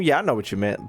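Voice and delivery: normal talking voice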